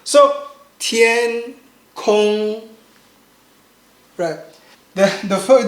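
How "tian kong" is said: TIAN KONG, slow flat syllables; first tone. Shown as a demonstration. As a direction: hold tian and kong level, each drawn out. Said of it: "making it bit long".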